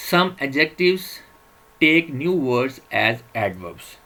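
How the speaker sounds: drawn-out teacher voice reading a slide; slow slide reading. Acted. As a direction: read the English heading out word by word, very slowly. Reads slide heading slowly.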